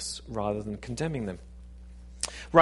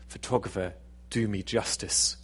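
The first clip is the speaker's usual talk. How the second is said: sultry purr; 4 semitones lower, 4 dB quieter than usual. Acted. Actress posing for her photographer, sultry purr. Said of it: "her best sultry manner ... She s-, purred".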